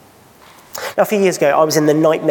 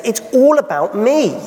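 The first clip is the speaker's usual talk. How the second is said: higher-pitched, mock-audacious announcement; bold proclamation; 7 semitones higher than usual. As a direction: lightly act out a bold self-declaration. Jesus's bold claim, retold boldly.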